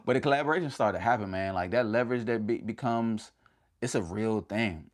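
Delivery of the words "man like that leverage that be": usual speaking voice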